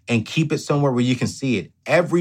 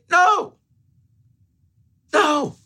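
firm no; 3 dB louder than usual. Acted. Emphatic refusal.

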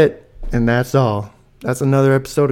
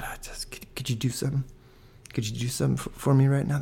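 conspiratorial whisper, much softer; whispering request; 7 dB quieter, faster than usual. Retold or imitated. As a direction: lean in and whisper the favour. Secretly asking teacher for song.

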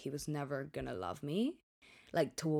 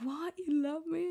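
much higher, astonished question; stunned disbelief; 13 semitones higher than usual. Retold or imitated. Girlfriend hearing I love you for the first time, astonished and high. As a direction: squeak out your shock. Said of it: "And I'm like".

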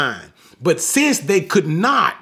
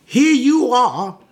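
higher, drawn-out mock indignation; offended Pharisees; 5 semitones higher, 3 dB louder, slower than usual. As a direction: act out taking offence, slowly. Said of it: "they became even more offended at him".

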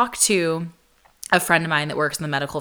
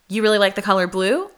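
rising, louder echo ending on "blue"; quick echo-question, voice up on "blue"; mirrored question; 5 semitones higher, 5 dB louder, faster than usual. Shown as a demonstration. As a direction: repeat it back as a question, lifting "blue". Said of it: "you just repeat".

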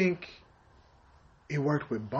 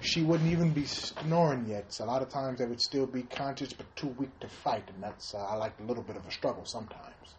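hushed, lower put-on comedian voice; Bill Cosby; 3 semitones lower than usual.

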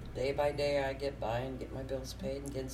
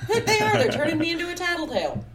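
high, loud, indignant squeal; fast, shrieked complaint of the put-upon; exasperated outburst; 10 dB louder, faster than usual. Real, not acted. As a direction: burst out in loud, high-pitched protest. Indignant about being made a tattletale.

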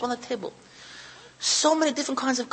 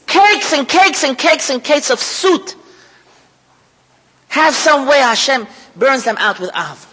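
fiery preaching; 3 semitones higher, 10 dB louder than usual. Acted. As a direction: preach it out to the whole room. Preaching loudly to congregation.

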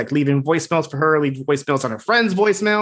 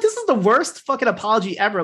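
exasperated; 6 semitones higher than usual. Real, not acted. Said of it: "I'm like".

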